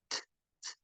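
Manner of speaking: crisp voiceless "t", no vowel; "t" as a tiny puff of air; isolated T sound. Shown as a demonstration. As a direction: tap out a bare "t" without voice. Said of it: "elas vão ter som de t".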